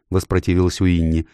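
usual speaking voice

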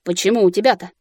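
ordinary delivery

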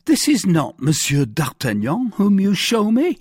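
high, loud protest, rising at the end; bewildered cry. A narrator's reading. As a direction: protest in baffled surprise. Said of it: "cried Bonacieux".

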